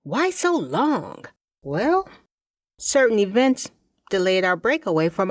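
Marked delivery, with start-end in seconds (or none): none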